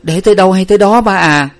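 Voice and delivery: high, loud, distraught retort; flustered. A narrator's reading. Daughter to fretting father, flustered and distraught. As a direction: blurt it out at a high pitch. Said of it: "Nguyệt rối ruột nói càng".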